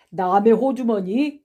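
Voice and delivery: loud, slow echoing question; drawn-out scoffing question; incredulous echo. Acted. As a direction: throw the words back in disbelief. Accuser scoffs at the retort.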